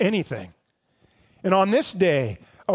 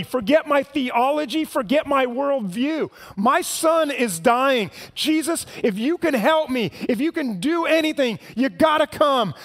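desperate pleading; 4 semitones higher than usual. Acted. Father begging Jesus to heal his dying son, rising desperate plea. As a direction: beg like your son's life depends on it. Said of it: "He's begging Jesus. He's pleading with Jesus".

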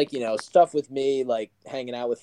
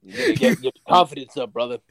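hammed-up wrestling promo voice; 4 dB louder, faster than usual.

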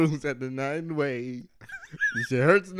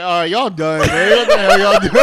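louder, laughing mock annoyed boss; laughing mock stage manager; 4 semitones higher, 13 dB louder than usual. Imitating the stage manager cutting them off, loud and laughing. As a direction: bark it through laughter like a fed-up manager. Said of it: "be like".

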